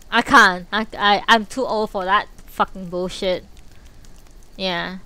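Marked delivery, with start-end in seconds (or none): none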